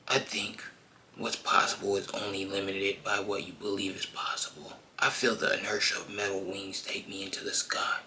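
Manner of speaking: soft, hushed brooding narration; whispered inner monologue. Acted. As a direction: murmur it like Batman's private thoughts.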